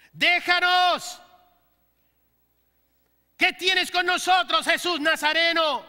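loud, high demonic cry. Acted. Preacher voicing the demon crying out at Jesus, loud high shout. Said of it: "El cual exclamó a gran voz, gritó".

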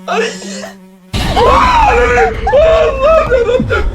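high panicked scream turning into a drawn-out laughing cry; terrified scream. Real, not acted. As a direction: shriek at the jump scare, then moan a prayer. Sudden ghost jump scare.

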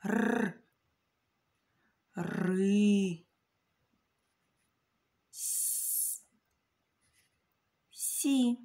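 р, ри, с, сі sounded out one piece at a time; slow sound-by-sound split. Shown as a demonstration. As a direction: pull рисі apart sound by sound, pausing between. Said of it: "Отже".

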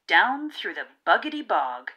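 plain reading voice

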